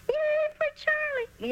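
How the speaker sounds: put-on very high, slow squeaky girl's cheer; a girl cheering Charlie's name from the front row, very high and squeaky; squeaky yell. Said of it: "yelled the girl who was the squeaky voice".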